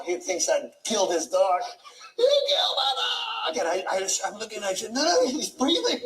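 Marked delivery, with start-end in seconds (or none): 2.2-3.6 shrill panicked owner, much higher; panicked high yell. Retold or imitated. 4.9-6.0 high excited reassurance. Retold or imitated. Reassuring panicked owner.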